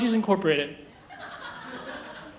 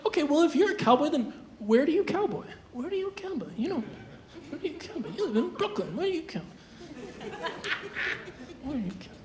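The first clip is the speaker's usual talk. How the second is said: skeptics questioning; 3 semitones higher, 12 dB quieter than usual. Retold or imitated. Doubters needling the comic about cowboying, soft nagging. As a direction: mutter it over and over, fading. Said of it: "A lot of people are like".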